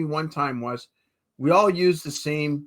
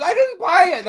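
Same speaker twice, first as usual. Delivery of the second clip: defensive protest; 13 semitones higher, 5 dB louder than usual. Retold or imitated. Arguing with angry wife.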